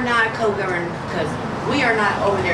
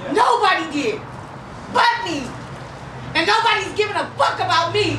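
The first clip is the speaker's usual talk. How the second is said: raw, furious, rising to a shout; real anger breaking through; angry outburst; 3 semitones higher than usual. Real, not acted. Grieving mother's outburst in meeting.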